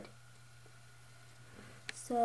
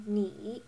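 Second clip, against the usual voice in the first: "ni" dipping then rising; third tone. Shown as a demonstration. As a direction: draw a check mark with the pitch on "ni". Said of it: "The third tone is the check mark".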